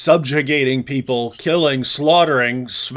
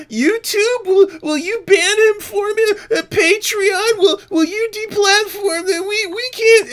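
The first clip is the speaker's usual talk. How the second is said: very high, loud fake-weeping plea; crybaby whine; 4 dB louder than usual. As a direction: whimper like a spoiled crybaby. They turn into these instant, uh, crybabies.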